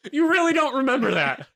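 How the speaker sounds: high, loud mock disbelief; incredulous shout. Doctor appalled at Craig's memory, incredulous shout.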